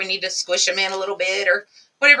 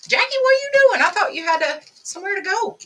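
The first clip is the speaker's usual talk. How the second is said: excited greeting; 10 semitones higher, 4 dB louder than usual. Real, not acted. Greeting a viewer joining live.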